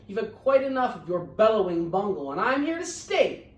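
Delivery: snapping. Acted. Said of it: "snapped the big kangaroo".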